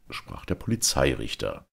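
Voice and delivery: ordinary delivery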